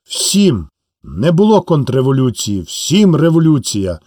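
ordinary delivery

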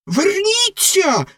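very high, slow stretched-out shriek; shrill shriek. A narrator's reading. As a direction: screech it desperately as she leaves. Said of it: "заверещала Ракета".

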